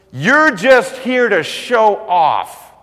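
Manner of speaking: slow, loud, high mocking jeer; sneering brother. Older brother mocks David, slow loud sneering voice. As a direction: drag out the jeer at the kid brother. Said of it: "And one of his brothers goes".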